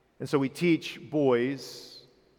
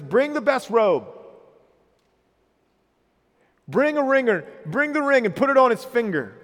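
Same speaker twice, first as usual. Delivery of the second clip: higher and louder, a father calling out in celebration; joyful father's orders; 6 semitones higher, 4 dB louder than usual. Acted. Father calling his servants at his son's return, joyful and loud. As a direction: announce the feast like an overjoyed dad.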